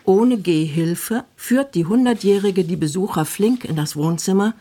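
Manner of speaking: ordinary talk, nothing marked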